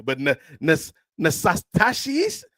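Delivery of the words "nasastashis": Nasastashis, high and drawn out slowly; mangled name. Shown as a demonstration. Host mocking customer's spelling, slow and high. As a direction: sound out Nasastashis in slow disbelief. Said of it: "that ain't Natasha".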